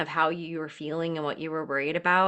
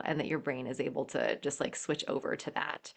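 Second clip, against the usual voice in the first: much softer, lower, almost whispered; hushed; 4 semitones lower, 9 dB quieter than usual. Real, not acted. Midwife moved by the mother's journey: hushed. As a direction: let it fall to a near whisper. Emotional praise, voice drops.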